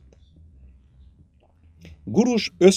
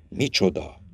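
low, softer uncomprehending question; puzzled; 5 semitones lower, 7 dB quieter than usual. A narrator's reading. Herdsman questions the bull's warning, puzzled. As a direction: ask it in quiet bewilderment. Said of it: "kérdezte a pásztor értetlenül".

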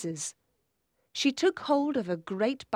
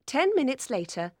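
usual speaking voice

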